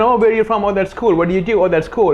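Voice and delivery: high, chirpy polite chatter; breezy surface-level chit-chat; bland small talk. Shown as a demonstration. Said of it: "instead of just having that conversation".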